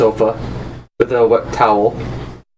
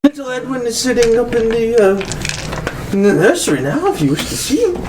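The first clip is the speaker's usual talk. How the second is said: breathy vintage purr; 7 semitones higher than usual. Acted. GM continues Lady Jezebel.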